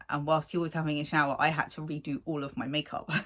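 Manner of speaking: ordinary talk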